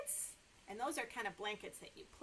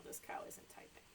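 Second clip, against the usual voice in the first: whisper; 10 dB quieter than usual. Real, not acted. Aside to kids.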